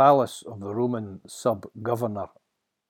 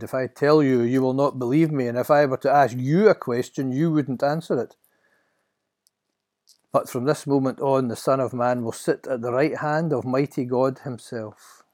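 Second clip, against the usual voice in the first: slightly raised, louder declaration; firm Jesus; 3 semitones higher, 7 dB louder than usual. Acted. Jesus answering the temple authorities, firm. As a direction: answer the accuser with conviction.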